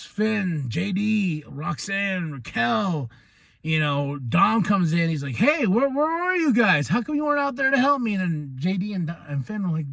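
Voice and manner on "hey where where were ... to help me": higher, fast, whiny complaint; whiny Dom. Retold or imitated. Dom asks his teammates why they did not help him, whiny and high.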